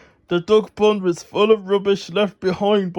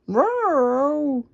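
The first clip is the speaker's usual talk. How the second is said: pitiful meow; 7 semitones higher than usual. Acted. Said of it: "Pilchard agreed pitifully".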